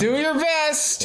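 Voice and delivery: high, slow, squeaky encouragement; high character voice. Acted. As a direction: stretch it out, high and cartoonish.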